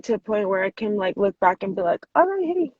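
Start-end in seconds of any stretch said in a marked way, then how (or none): 2.1-2.7 high, louder, breezy call-out; breezy acceptance. Retold or imitated. Looking back at her old self, breezy acceptance. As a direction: greet it lightly, voice lifted and louder.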